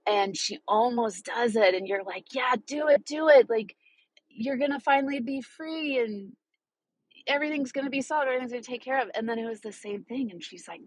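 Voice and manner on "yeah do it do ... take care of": high, eager cheering her on; urging her on. Retold or imitated. Talking to viewers about the movie, eager urging. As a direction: push her to say yes, excitedly.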